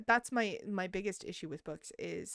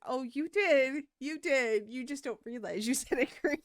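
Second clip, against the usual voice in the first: laughing; 6 semitones higher, 4 dB louder than usual. Retold or imitated. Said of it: "And I'm like".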